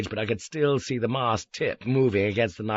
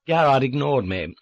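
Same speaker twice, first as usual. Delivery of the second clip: ordinary delivery, nothing marked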